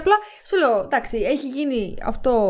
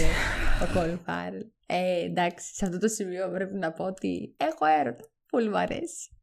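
softer, lovestruck gushing over a crush; dreamy swooning; 4 semitones lower, 7 dB quieter than usual. Real, not acted. Gushing to her co-host about her crush, softly swooning. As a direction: sigh it out like a smitten fan. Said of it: "κι εγώ έτσι κάνω όταν μιλάω για την Elizabeth Olsen".

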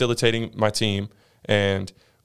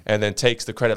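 normal talking voice

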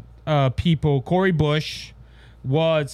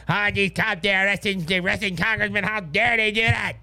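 lightly voiced outraged pundit, a bit higher; mild mock indignation at the cops; mocking the media; 3 semitones higher than usual. Host mimicking media outrage over arrested congressmen, lightly mocking voice. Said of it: "Now the media is making this big".

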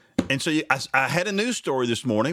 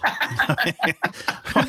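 laugh; 4 semitones higher than usual. Real, not acted. Host laughs at guest's reaction.

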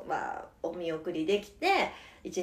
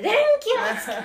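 a hint of a sleepy child; 13 semitones higher, 7 dB louder, faster than usual. Acting voice for switching light.

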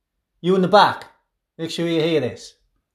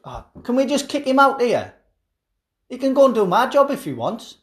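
ordinary delivery

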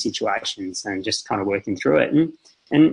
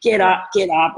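much higher, half-raised urgent command; retold raised voice; 8 semitones higher than usual. Dad ordering his son off the bike path, retold raised voice. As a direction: re-enact an exasperated order, short of shouting. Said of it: "really raised my voice".

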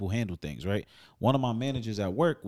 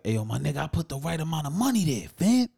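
angry customer; 6 semitones higher, 3 dB louder than usual. Retold or imitated. Angry customer voiced in story.

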